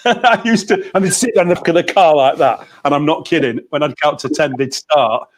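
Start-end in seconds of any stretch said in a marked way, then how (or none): 0.0-0.9 slow, high, breaking into giggles; laughing. Real, not acted. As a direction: laugh while telling it. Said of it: "it's happening to me now".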